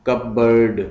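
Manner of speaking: cupboard in two beats, cup then board; split into syllables. Shown as a demonstration. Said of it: "टू syllables हैं इसके".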